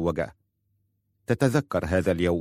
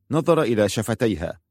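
ordinary delivery, nothing marked

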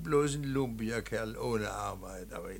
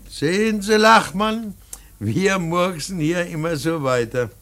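emphatic; 4 semitones higher, 9 dB louder than usual. Acted. Kramer addresses painter emphatically.

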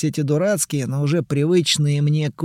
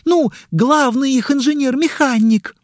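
excited fan; 10 semitones higher, 4 dB louder than usual. Acted.

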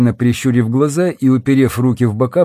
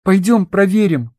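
put-on high insistent girl; 7 semitones higher than usual. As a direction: push your point firmly. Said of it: "настаивала Ольга".

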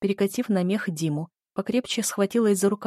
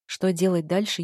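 plain reading voice

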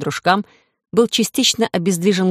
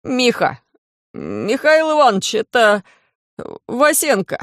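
scared high voice; 4 semitones higher than usual. Acted. Scared thug names boss.

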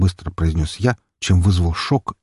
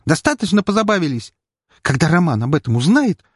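indignant; 9 semitones higher than usual. A narrator's reading. Continued indignation.

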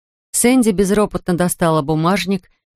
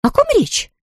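high, puzzled question; surprised. Acted. Blonde to father and daughter, surprised. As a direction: caught off guard, pitched high. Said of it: "снова удивилась Сэнди".

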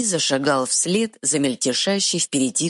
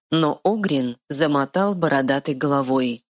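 usual speaking voice

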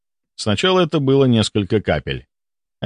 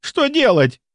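high, desperate question; panicked cry; slower than usual. A narrator's reading. Nurse begs the doctors for direction, panicked cry. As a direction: cry it helplessly, pitch leaping up. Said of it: "кричала медсестра".

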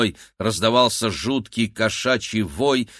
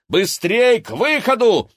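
high, loud urgent order; shouted command; 12 semitones higher, 6 dB louder than usual. A narrator's reading. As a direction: bark the order to run. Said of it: "скомандовал Игорь".

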